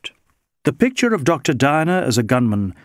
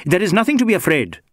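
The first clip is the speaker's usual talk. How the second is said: quick, higher-pitched, testy retort; irritated inspector, hurried and raised in pitch; snapped out; 7 semitones higher, 3 dB louder, faster than usual. A narrator's reading. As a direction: snap it out fast, brushing fear aside. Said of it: "he snapped out".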